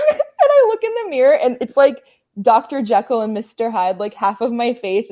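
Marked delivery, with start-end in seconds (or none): 0.1-2.0 laughing. Real, not acted. Laughing at her ruined reflection.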